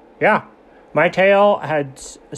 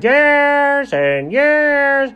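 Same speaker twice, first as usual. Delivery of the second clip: slow, drawn-out speechifying; pompous salesman milking the moment; dragging it out; 7 semitones higher, much slower than usual. Retold or imitated. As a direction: stretch every word like a windbag. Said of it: "Come on, buddy, get on with it".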